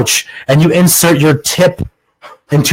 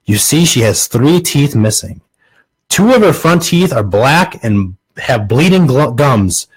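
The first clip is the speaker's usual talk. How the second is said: normal talking voice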